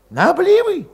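put-on high, agitated young cossack voice; Valet voiced high. A narrator's reading. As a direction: push it up high and restless.

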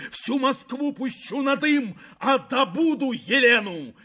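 high, ranting shout; wild high-pitched boast; furious defiance. A narrator's reading. Prince raging at the door.

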